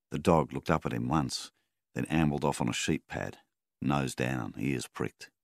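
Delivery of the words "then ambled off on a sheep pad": ordinary delivery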